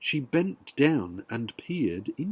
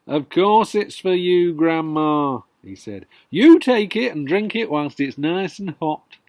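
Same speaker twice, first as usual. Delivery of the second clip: sweetly; 2 semitones higher, 8 dB louder than usual. Acted. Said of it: "smiled sweetly".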